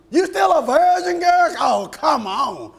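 loud, high imitation of teasing girls; mocking high-school girls. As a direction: taunt a friend in mock disbelief. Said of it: "they talk to each other like that".

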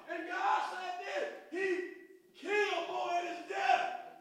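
loud, high-pitched rising sermon shout; full-throated gospel preaching; preaching at full force. Acted. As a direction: whip the congregation up with the message.

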